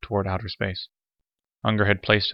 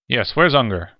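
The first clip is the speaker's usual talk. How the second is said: loud, higher demand; 4 semitones higher, 7 dB louder than usual. A narrator's reading. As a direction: bark out the question, wanting answers now. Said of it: "Gannett demanded".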